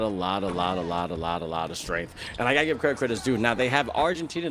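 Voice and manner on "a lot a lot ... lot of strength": sinking repetition. Acted. Repeated phrase dropping lower.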